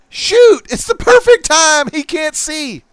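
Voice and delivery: hammed-up cowboy shout; gleeful gunman. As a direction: shriek it like a villain seizing his chance. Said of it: "Now he's like".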